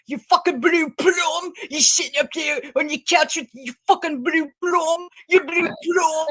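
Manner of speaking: high, loose Liverpool rant impression; Carl. Mimicking a man going off on Ziggy, pissed-off Scouse rant. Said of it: "me impersonating Carl, who's from Liverpool".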